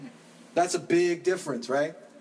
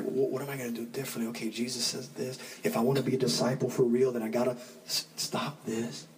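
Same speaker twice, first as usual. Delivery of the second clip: hushed worried self-talk; 6 semitones lower, 4 dB quieter than usual. Retold or imitated.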